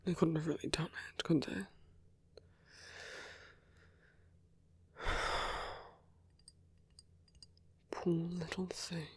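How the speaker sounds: soft, hushed muttering under the breath; quiet private murmur; musing to oneself. Acted. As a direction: think aloud, barely voiced. Muses alone before the meeting.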